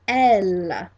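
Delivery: "elle" said clearly in French, drawn out; model pronunciation. Shown as a demonstration. Teacher has class repeat the word for she, model pronunciation. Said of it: "pronounce after me".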